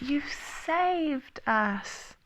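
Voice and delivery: very slow, high exclamation; high, stretched storybook cry of gratitude. A narrator's reading. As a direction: draw each word out high with relief. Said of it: "cried Piglet".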